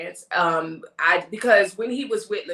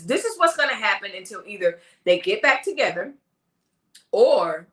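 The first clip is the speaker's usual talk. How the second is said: ordinary delivery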